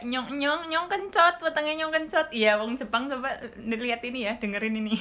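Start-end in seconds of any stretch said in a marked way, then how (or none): 0.1-2.3 louder, higher Ngapak Javanese sing-song; hammed-up Ngapak complaint of hunger; Ngapak dialect. Shown as a demonstration. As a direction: show off broad Banyumas Ngapak, hungry and playful. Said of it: "Ini ngapak ya".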